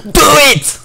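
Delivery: loud, high shout of do it; imitating Chia. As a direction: bark it out as a hyped slogan. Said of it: "une imitation de Chia les Moufs".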